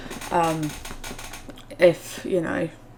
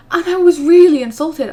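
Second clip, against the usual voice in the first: louder and higher, still offended; indignant; 10 semitones higher, 10 dB louder than usual. Real, not acted. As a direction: relive the insult out loud. Retelling indignant complaint.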